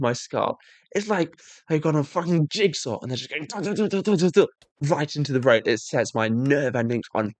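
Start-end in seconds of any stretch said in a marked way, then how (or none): none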